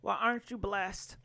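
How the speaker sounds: normal talking voice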